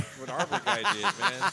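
loud words broken by laughter; laughing. Real, not acted. Laughing at scene.

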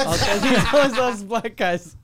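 laughing through the words; can't hold back the laugh; giggling while retelling a joke. Real, not acted.

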